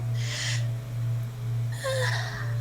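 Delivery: long weary sigh, breathy and drawn out; tired sigh. Real, not acted. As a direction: sigh out all the exhaustion. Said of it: "tired guy with emphasis on the tired".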